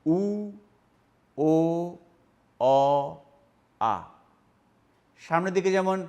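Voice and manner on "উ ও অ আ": উ, ও, অ, আ said slowly, the lips rounded and the mouth opening wider each time; back vowel demo. Shown as a demonstration. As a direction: sound out উ, ও, অ, আ from closed to open. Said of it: "উচ্চারণ হবে আ".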